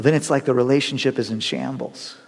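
ordinary delivery

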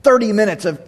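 ordinary talk

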